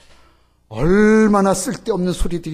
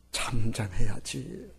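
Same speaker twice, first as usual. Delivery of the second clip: ordinary talk, nothing marked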